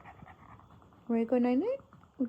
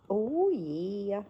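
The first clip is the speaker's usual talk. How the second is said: ordinary talk, nothing marked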